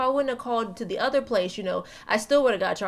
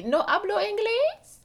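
put-on high, slow Spanish-accented "No hablo inglés"; fake Spanish accent; 10 semitones higher, slower than usual. Dodging the bill collector on the phone, fake Spanish accent. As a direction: pretend not to speak English, pitched up. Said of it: "I used to always be".

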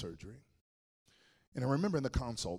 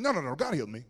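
louder, higher retold insistence; insistent correction; 4 semitones higher, 9 dB louder than usual. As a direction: cut in and insist, pushing the volume. Insisting against the doctor's hedging.

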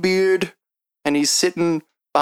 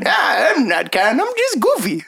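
put-on high goofy old man voice; tiny old man voice; 7 semitones higher than usual. Shopkeeper speaks in character.

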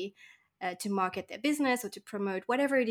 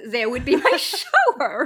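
laughing; 3 semitones higher, 10 dB louder than usual. Real, not acted. Laughing at cramped house.

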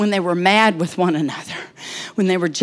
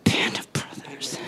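slow whispered litany; 5 semitones lower, 9 dB quieter than usual. Acted. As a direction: list each word in a hush, slowing down.

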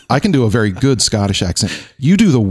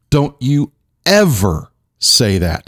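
slow, emphatic mock warning; mock scolding; 2 semitones higher, slower than usual. Real, not acted. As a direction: scold slowly, spacing out each word. Mock scolding over a mispronunciation.

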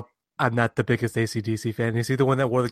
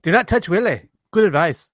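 higher-pitched cartoon Scottish accent; mock Scottish brogue, pitched up; Groundskeeper Willie; 6 semitones higher than usual. Shown as a demonstration. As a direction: do a comic Scots groundskeeper reading aloud. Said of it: "give me your best groundskeeper, Willie".

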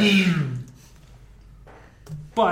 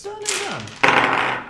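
silly high voice; slower than usual. Acted. GM affirming with put-on voice.

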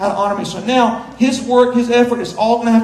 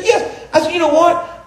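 laughing delight; 6 semitones higher than usual. Retold or imitated. Retold delighted exchange with wife.

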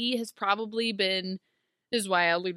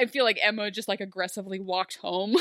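usual speaking voice

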